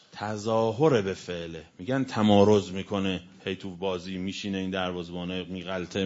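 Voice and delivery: ordinary talk, nothing marked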